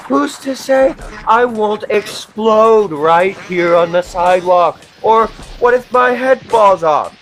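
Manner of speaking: mounting panic. Acted. Comic spiralling into panic about exploding on the sidewalk. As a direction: spiral into mock existential panic.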